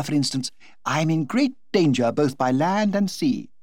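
Lady Windermere chatters happily to the Duchess; gushing society hostess. Acted. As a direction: toss it off like a delighted society hostess.

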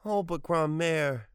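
high, slow, pleading wail; piteous wail. A narrator's reading. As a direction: wail it out pitifully, drawing it out. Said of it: "he wailed piteously".

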